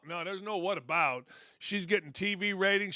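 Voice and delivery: ordinary talk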